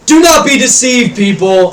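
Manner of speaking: loud, high magically amplified address; thundering orator's cry; booming proclamation. Acted. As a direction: boom it out over a crowd. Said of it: "his voice is booming".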